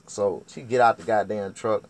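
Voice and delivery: normal talking voice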